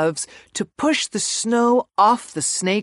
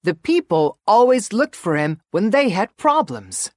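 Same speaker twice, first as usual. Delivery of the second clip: ordinary delivery, nothing marked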